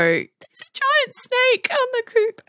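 high, slow panicked cry about the snake; panicked yell. Retold or imitated. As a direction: squeal the warning like you just saw a snake. Panicked warning to dad.

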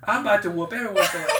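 loud, high imitation of exasperation; mock fed-up threat. Podcaster retelling Carter fed up with the exchange, mock threat. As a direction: threaten them all, hammed up.

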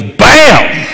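high, punchy "Bam!" like a gunshot; mock gunshot. Uncle firing at a snake, imitated gunshot "Bam!". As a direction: blurt it out like a pistol going off. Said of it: "and goes".

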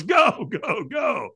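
high, urgent shout, easing at the end; trainer's launch shout. Retold or imitated. Trainer sends firewalker onto the coals, shouting. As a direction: send the walker off with a yell.

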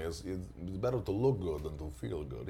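smooth and vain, savor it; Fernando. Retold or imitated. Doing SNL's Fernando for parents, suave and smooth. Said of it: "And I would do Fernando".

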